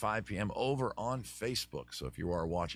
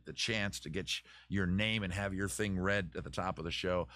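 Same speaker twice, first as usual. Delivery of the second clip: usual speaking voice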